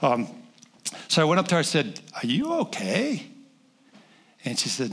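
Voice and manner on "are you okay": gentle concern. Retold or imitated. Approaching crying woman gently.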